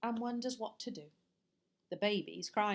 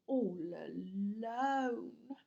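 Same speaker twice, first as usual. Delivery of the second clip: tender burglar; much slower than usual. Acted. Burglar pitying the lonely baby, slow and tender. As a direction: linger gently over the words.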